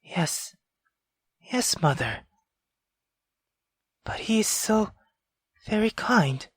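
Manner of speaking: put-on eerie young girl whispering to her mother; girl talking to her mother. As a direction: answer an unseen mother in hushed pleading. Girl talks to unseen mother.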